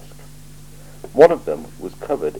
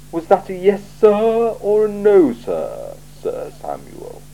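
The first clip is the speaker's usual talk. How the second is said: slow, higher, measured patrician voice; a cool ruler weighing every word; icy, deliberate interrogator; Lord Vetinari; 10 semitones higher than usual. Acted. Vetinari scolds Vimes.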